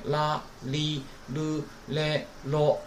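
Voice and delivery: 啦、哩、噜、嘞、啰 with the tongue tapping the palate; separate, slow la li lu le lo on 啦、哩、噜、嘞、啰; tongue-tap l. Shown as a demonstration. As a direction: demonstrate a clear l in 啦、哩、噜、嘞、啰. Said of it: "要碰一下那个上牙膛".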